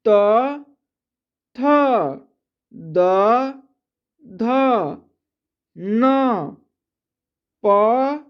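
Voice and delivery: slow, spaced-out letter recitation; reciting letters. Shown as a demonstration. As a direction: call each consonant clearly with pauses. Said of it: "ठीक है।". (अक्षरों का धीमा उच्चारण)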